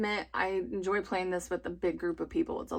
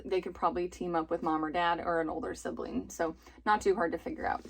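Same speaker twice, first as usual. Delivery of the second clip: ordinary talk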